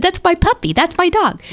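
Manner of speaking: high-pitched insistent delight; excited claim. Retold or imitated. Friend insists the new puppy is hers, high excited. Seeing the Pomeranian, retold claim.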